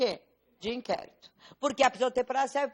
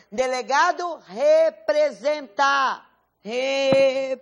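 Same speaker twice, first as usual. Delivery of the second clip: "representa" louder and slowed down; stressed key word; 6 dB louder, much slower than usual. Shown as a demonstration. Teacher stressing the correct legal term for students. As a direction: drill the word "representa", slow and loud. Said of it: "Essas são palavrinhas, porque cai na prova".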